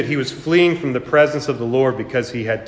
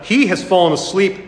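usual speaking voice

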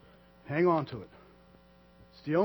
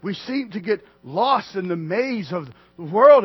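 ordinary talk, nothing marked

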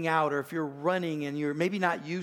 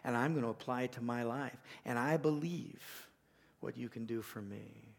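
quiet prayer; 5 semitones lower, 8 dB quieter than usual. Shown as a demonstration. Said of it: "That you say".

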